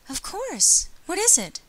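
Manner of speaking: put-on high-pitched girlish voice; eager heroine, concerned. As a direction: answer readily in a high, sweet voice. Voices Hiyoko replying to Ryota.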